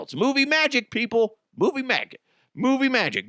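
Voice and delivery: high, emphatic showman call; theatrical insistence. Acted. Defending laughing at gore to the audience, high showman insistence. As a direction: sell it like a carnival barker.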